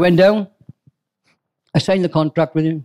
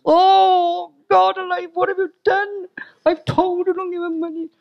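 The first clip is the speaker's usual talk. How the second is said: much higher, squealing mock alarm; shocked Nigel. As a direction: play the horrified friend at a high pitch. Friend Nigel reacts to big payment.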